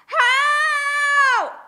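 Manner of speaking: high loud howl; loud, high, howling cry; pained howl. Acted. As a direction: howl in agony. Finger bitten off.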